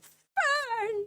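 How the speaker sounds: put-on father clutching the girl's face, crying out; joyful cry. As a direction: shout her name through happy tears. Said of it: "grabs Fern's face and just like".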